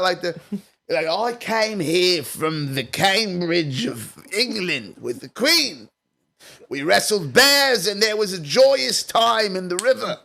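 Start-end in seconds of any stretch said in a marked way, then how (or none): 1.1-10.2 slow, put-on old English accent, posh and grand; a hammed-up old English accent. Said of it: "that old English".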